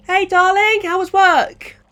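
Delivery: a mother greets her son home; calm older mother. Acted. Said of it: "an older and calmer Georgina".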